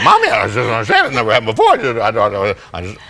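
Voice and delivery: hammed-up frantic child, fast and loud; freaking out. Four-year-old freaking out at mom, mock frantic babble. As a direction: imitate a kid spluttering into a meltdown. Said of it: "He's freaking out".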